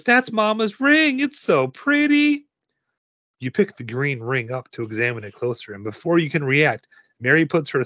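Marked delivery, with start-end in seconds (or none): 0.0-2.5 put-on very high, slow, wondering child voice; awed little girl. A narrator's reading. Girl marvelling at her mama's ring, high and awed. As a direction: coo over a treasure in a high voice.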